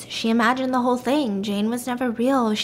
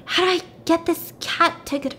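higher-pitched exasperated voice; super frustrated; 6 semitones higher than usual. Retold or imitated. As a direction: act out helpless frustration, breaking off. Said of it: "she's super frustrated".

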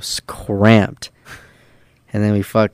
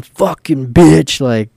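louder, higher, drawn-out angry cursing; retold yelling; 6 semitones higher, 5 dB louder, much slower than usual. Turned-away traveler cursing at the airport, retold yelling. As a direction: imitate a man screaming curses, part strength. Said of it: "He's fucking yelling, screaming".

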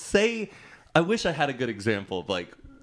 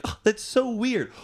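high-pitched mock astonishment; mock amazement; 13 semitones higher than usual. Mimicking TikTok hosts reacting to exchange student, mock amazement. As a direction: act amazed, voice jumping up high. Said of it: "they're saying it, like".

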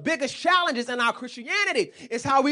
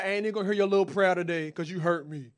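petty God; 5 semitones lower, faster than usual. Pretend. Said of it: "What if he changed up?".

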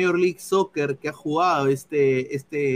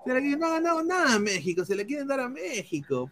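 indignant rant; 5 semitones higher, 8 dB louder than usual. Real, not acted. Ranting about conspiracy.

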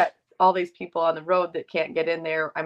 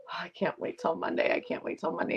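whispered, breathy repetition of the line; hushed eager quote; 6 dB quieter than usual. Retold or imitated. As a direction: murmur it like a secret thrill. Excited texting quote repeated.

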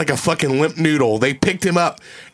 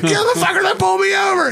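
surprised recognition. Retold or imitated. Sudden recognition retold.

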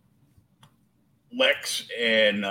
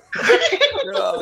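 high laugh; 7 dB louder than usual. Real, not acted. Group laughing at the mix-up.

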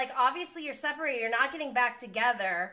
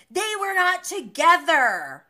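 high, loud, slow protest in imitation; imitated loud interjection; 6 semitones higher, 10 dB louder than usual. Host as Sandoval jumping into the argument, loud protest. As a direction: butt in loudly, acting out the protest.

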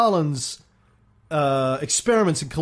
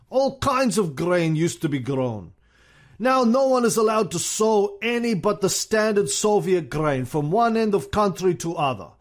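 Stalin impression; 4 semitones higher than usual. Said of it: "Then Stalin said".

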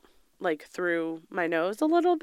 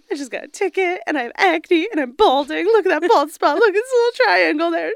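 higher, louder hammed-up weepy wail; imitating crying girl; 9 semitones higher, 8 dB louder than usual. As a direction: play up a tearful meltdown for the camera. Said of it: "And she's crying".

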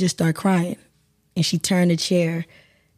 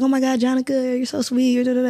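a hint of sweet gushing, higher pitched; imitating gushing coworkers; 5 semitones higher than usual. Coworkers greet the grieving actress at work, lightly gushing. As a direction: lightly mimic fussing colleagues in a higher voice.

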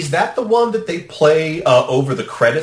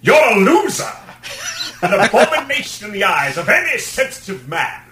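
high, theatrical impression of a flamboyant actor; grand, hammed-up duet delivery, pitched way up; Tim Curry; 7 semitones higher than usual. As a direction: belt it out high like a musical villain. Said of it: "imagine dual duet with Tim Curry".

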